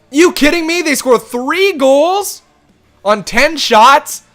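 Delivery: high, loud, slow disbelief; can't believe the goals against, drag it out; real disbelief. Real, not acted. Ongeloof over tegendoelpunten.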